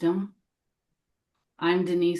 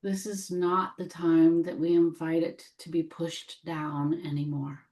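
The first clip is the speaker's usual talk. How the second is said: measured meditation voice, long silences between phrases; gently invite, leaving room after each phrase; guided meditation; 4 dB quieter than usual. Real, not acted. Guide welcoming what needs healing, gentle meditation voice.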